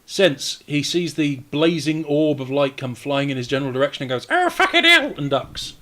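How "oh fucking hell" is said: high, loud cry of alarm; startled yelp. Acted. Game master voices an enemy dodging holy light, startled high yelp. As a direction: yelp as if something's flying at you. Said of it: "said it like that".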